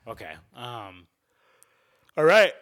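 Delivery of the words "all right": said through a yawn. Real, not acted. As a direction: yawn while saying it. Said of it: "Pardon my yawn".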